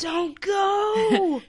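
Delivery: whispered shout at the screen; hiss-yell a warning at a horror film; movie theater girls. Acted. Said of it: "you sound like the girls at the movie theater".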